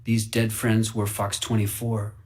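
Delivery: ordinary delivery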